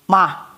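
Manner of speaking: Ma as one short low syllable; shortened mamá. Retold or imitated. Mother imitating her child's call, shortened mamá. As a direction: cut mamá down to a brief, low Ma. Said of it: "Más corto".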